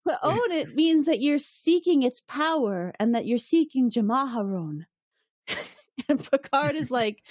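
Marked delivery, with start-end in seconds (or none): 0.1-4.8 higher, drawn-out imitation of the woman; suggestive explanation. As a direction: spell it out slowly as the seductress. Voices the woman explaining the symbol.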